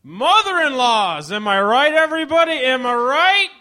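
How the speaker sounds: cheesy stand-up voice; hack comic. Acted. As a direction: sell the tired joke hard to the crowd. Mock hack comic monologue opener.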